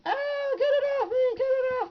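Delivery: high, frantic cry for help; panicked figure voice. Pretend. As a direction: shriek it off like a spider's biting. Figure voiced under spider attack.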